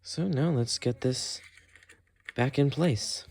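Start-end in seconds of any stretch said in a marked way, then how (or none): none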